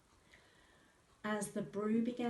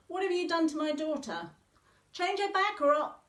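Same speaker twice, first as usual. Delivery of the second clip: high, loud, fast furious yell; 9 semitones higher, 10 dB louder than usual. A narrator's reading. Father demands the witch undo the spell, yelling. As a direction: rage at her as she flees. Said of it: "he yelled".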